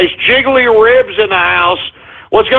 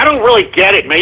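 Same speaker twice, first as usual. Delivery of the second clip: usual speaking voice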